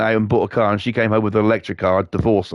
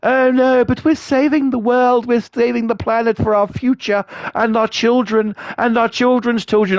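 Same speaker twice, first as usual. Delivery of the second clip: shrill send-up of someone saving the planet; mocking woke activist; 10 semitones higher than usual. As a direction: sneer it out high like a self-righteous activist.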